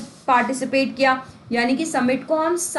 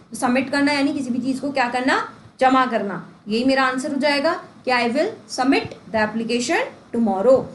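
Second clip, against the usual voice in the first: ordinary delivery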